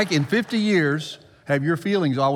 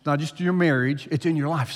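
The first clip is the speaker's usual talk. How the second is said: ordinary talk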